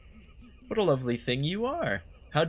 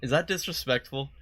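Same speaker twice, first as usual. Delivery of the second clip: normal talking voice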